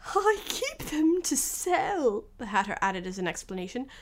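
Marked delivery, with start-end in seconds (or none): 0.0-2.2 high, slow, pleading character voice. Acted. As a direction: explain it slowly in a high, anxious voice. Hatter explains himself to King.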